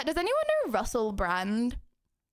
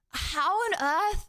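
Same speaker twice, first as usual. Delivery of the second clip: ordinary talk, nothing marked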